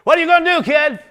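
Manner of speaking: loud high taunt. Acted. Laughing crowd taunting young David. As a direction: jeer at the kid. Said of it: "they're laughing at him".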